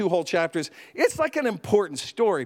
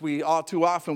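ordinary delivery

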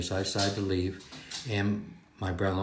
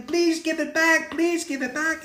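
put-on pleading little girl; 4 dB louder than usual. Girl reenacts begging the boy for her pencil, high whiny plea. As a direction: beg for the pencil back, tiny and high.

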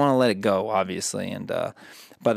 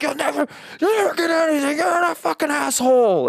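much higher, louder mock rage; furious old man; 4 dB louder than usual. Owner imitating the old man crumpling blueprints, mock furious shriek. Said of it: "He's like".

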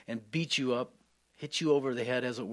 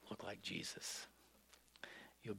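hushed, softer whisper; 4 semitones lower, 18 dB quieter than usual. Real, not acted. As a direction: let it fall to a reverent whisper. Preacher drops to a hush.

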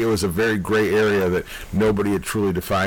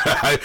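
sudden high shrieking laugh; 6 semitones higher than usual. Real, not acted. Laughing hard at his own near-joke.

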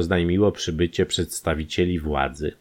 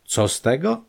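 higher, irritated repeat; impatient echo; 9 semitones higher than usual. A narrator's reading. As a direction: toss the question back impatiently. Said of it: "powtórzył niecierpliwie lekarz".

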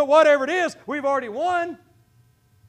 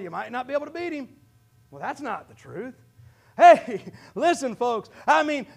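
ordinary delivery

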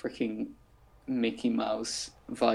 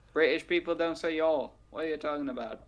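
higher, put-on British accent; badly faked British retort; mock British accent; 4 semitones higher than usual. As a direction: echo it back in a bad British accent. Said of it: "This isn't a British accent either".

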